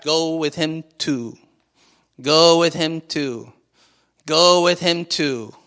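slow, repeated gentle urging; slow insistent urging. Acted. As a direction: press it on him slowly, again and again. Holy Spirit speaking to heart.

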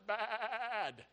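long, wavering bleat on BAD; sheep bleat. Preacher joking that we are dumb sheep, bleated BAD.

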